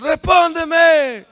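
high, loud demand; acted desperate shouting; another prophet's shouted plea. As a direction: bellow it up to a silent god. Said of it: "le decía otro".

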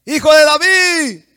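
high, very loud drawn-out cry; full-lung shout. Acted. Blind man cries out to Jesus over the crowd, full-lung shout. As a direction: fill your lungs and holler for mercy. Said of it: "mejor agarró aire con sus pulmones".

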